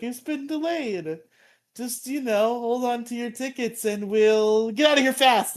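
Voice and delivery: squeaky excuse before running off; goofy voice. Retold or imitated. Bulk and Skull stalling the crowd, in a high goofy voice.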